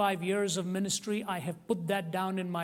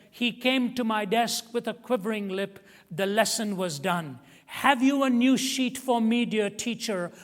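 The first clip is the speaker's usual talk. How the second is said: high, loud and fervent poem reading; fervent poem recital, high and loud; 4 semitones higher, 8 dB louder than usual. Shown as a demonstration. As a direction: declaim the poem with rising passion. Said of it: "this is the way the poem went".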